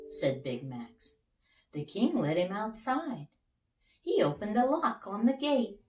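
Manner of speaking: ordinary delivery, nothing marked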